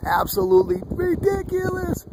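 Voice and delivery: squeaky falsetto of disbelief; high squeal. Real, not acted. Vlogger squeals at the sky face.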